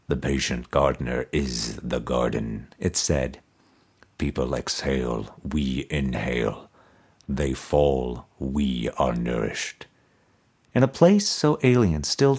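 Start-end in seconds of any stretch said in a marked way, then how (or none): 0.1-2.7 low, soft, toneless voice of a buried skull; resonant toneless skull. A narrator's reading. Buried emperor's skull answering Mordecai, low resonant toneless voice. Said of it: "answered in a resonant voice, toneless". 4.2-9.9 low, soft, toneless voice of a buried skull; resonant toneless skull. A narrator's reading. Buried emperor's skull answering Mordecai, low resonant toneless voice. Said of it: "answered in a resonant voice, toneless".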